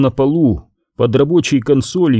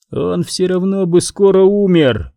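usual speaking voice

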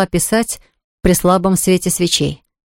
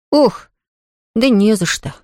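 embarrassed; 3 semitones higher than usual. Acted. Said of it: "смущённо".

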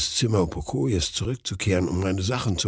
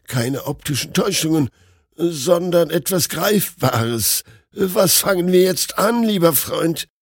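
higher, rising to an anxious plea; frightened doctor; 6 semitones higher than usual. A narrator's reading. Doctor asking his friend what to do about the coffins. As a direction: reason it out, panic growing.

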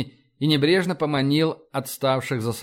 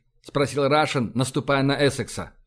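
ordinary delivery

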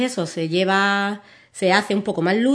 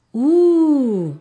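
high, louder playful wolf howl; 8 semitones higher, 6 dB louder than usual. Acted. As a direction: howl like a friendly storybook wolf. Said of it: "Y yo hago el sonido". (aullido de lobo juguetón)

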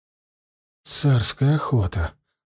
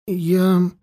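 higher pitched, flustered stammer; flustered babbling; 6 semitones higher, slower than usual. A narrator's reading. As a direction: blurt it out helplessly, like a lost boy. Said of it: "растерявшись, пролепетал Хельми". (растерянно, лепеча)

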